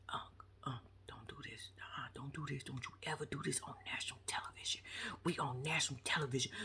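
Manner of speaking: hushed whisper, urgent. Retold or imitated. Nephew begging his uncle in a whisper. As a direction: whisper a plea so nobody hears. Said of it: "all that whispering you was doing".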